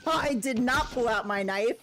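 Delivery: normal talking voice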